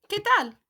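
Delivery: high, bright "¿Qué tal?" falling on tal; cheerful model greeting for students; model for repeating. Shown as a demonstration. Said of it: "Repeat after me".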